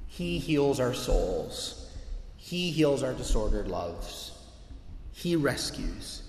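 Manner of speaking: each phrase drawn out slowly; slow emphatic close. Real, not acted. Preacher closing before communion, slow and deliberate. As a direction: let every verb land, slowly.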